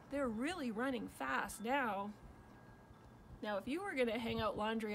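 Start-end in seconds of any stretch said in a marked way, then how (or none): none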